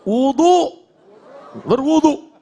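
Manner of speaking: ordinary talk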